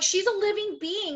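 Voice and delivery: high-pitched, upset insistence; upset protest. Retold or imitated. Woman defending the hamster to her fiancé, high upset protest. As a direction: plead it way up high, retelling an argument. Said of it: "then I get, like, upset".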